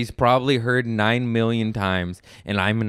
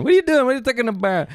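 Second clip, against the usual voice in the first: Italian accent; 11 semitones higher, much faster than usual. Shown as a demonstration. Host showing how he turned Italian, high Italian accent. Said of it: "I just turned Italian".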